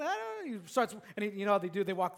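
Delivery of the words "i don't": put-on whiny child voice. Son voiced refusing.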